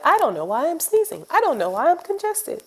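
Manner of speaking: high, mock-innocent self-quote; mock clueless self. Stubborn reader mocks herself pretending not to be sick, high and innocent. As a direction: play dumb about being sick. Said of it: "and be like".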